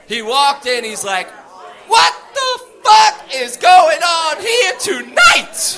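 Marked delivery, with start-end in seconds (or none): 1.9-5.8 high, slow, shouted disbelief; shocked shout. Retold or imitated. New arrival yells at the crowded cell, shocked and loud. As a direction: bellow it out in amazement, half laughing.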